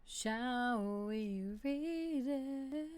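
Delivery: very slow stretched question; slow sing-song invitation; drawn out. Pretend. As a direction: stretch it out teasingly. Drawn out teasing.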